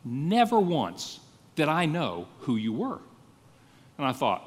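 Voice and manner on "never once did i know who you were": slow, weighty "Never once", set apart by a pause; equally emphatic. Retold or imitated. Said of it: "he's equally emphatic to say".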